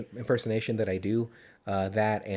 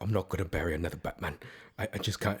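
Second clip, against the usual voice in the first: low, soft attempt at a crying Brit; lightly acted tearful British impression; tearful Michael Caine; 5 semitones lower, 5 dB quieter than usual. Podcaster imitating Caine crying over Batman, mildly tearful British accent. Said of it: "Michael Caine's crying".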